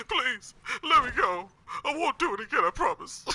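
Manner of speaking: high, whiny mock begging; hammed-up plea for mercy, rising to a yell; desperate cracking pleading. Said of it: "That dude was begging for his life".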